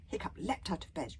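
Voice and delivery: ordinary delivery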